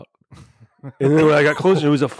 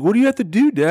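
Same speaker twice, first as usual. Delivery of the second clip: a lightly voiced young son, pitched higher; kid voice; 8 semitones higher, faster than usual. Dad imitating his son's confused question, higher kid voice. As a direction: play the puzzled little boy asking his dad.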